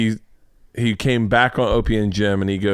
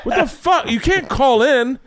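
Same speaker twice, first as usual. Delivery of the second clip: much higher, louder mock-furious yell; imitating angry comic; 12 semitones higher, 3 dB louder, slower than usual. Comic Kevin on air yells at Bert, high and loud. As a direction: rant at him, slowing on the last words. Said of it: "He goes".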